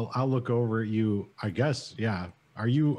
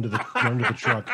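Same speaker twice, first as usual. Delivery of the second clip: chuckling through the line; giggling while describing escape; laughing; 3 dB louder, faster than usual. Real, not acted. Player laughing at own joke.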